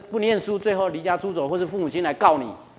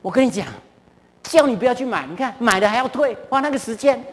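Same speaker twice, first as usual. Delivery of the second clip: higher, nagging wife's voice, a little put on; scolding wife; 5 semitones higher than usual. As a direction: play the wife telling him off for wasting time. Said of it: "我太太就说".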